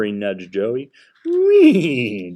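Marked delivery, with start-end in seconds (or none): 1.3-2.3 high, loud horse whinny. A narrator's reading. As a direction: neigh it out like a real horse. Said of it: "Joey whinnied".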